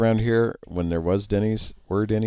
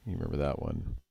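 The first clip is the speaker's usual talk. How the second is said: usual speaking voice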